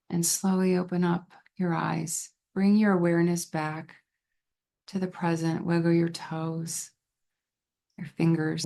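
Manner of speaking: calm guided relaxation with long pauses between phrases; guided visualization. Shown as a demonstration. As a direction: lead them gently into calm, leaving space to breathe.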